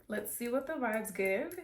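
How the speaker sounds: ordinary delivery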